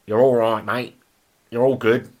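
put-on Aussie accent; Australian accent. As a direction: say it like a matey Australian. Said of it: "in an Australian accent".

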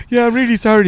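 put-on high-pitched sheepish kid apology; mock-contrite little voice; imitated apology. Child's apology to neighbour acted out.

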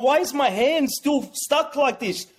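high-pitched, mildly panicked question; retold freak-out. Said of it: "I'm freaking out 'cause I'm going".

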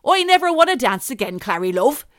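loud, high West Country burr. Acted. Host sending up Careless Whisper as a farmer, hammy West Country. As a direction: belt it out in a broad West Country accent. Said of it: "Eddie Grundy doing Careless Whisper".

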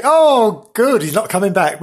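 a hammed-up relieved "oh, good"; mock delighted sneer; mock relieved listener. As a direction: play a listener glad he's gone. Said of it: "Some people would say".

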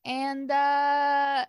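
slow country drawl; country accent. Acted. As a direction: introduce yourself in a drawn-out country twang. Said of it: "natives here have a country accent".

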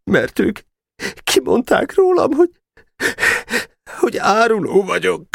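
magasabb, elakadó, sírásközeli hang; küszködj a könnyekkel, akadozva; sírós hang. Acted. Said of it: "pityergőre álló hang felelt". (pityergő, elcsukló hang)

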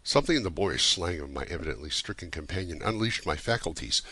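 ordinary delivery

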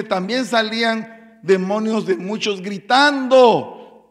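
loud, slow preaching; preaching emphasis. Acted. Preacher stressing shouting demons, loud and slow. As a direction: drive it home from the pulpit.

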